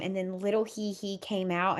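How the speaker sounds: ordinary talk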